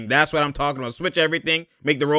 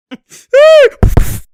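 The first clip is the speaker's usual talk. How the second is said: loud high cackle at the play; loud burst of laughter; 13 dB louder than usual. Real, not acted. Laughing loudly at a botched play. Big laugh watching game.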